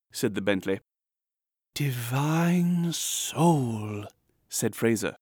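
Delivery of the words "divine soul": long-drawn, high adoration; rapturous reviewer. A narrator's reading. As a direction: stretch out each word in rapture. Journals acclaim the writer.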